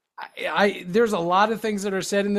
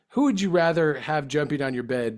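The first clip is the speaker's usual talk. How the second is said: normal talking voice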